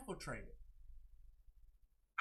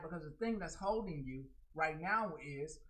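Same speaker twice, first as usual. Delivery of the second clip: ordinary talk, nothing marked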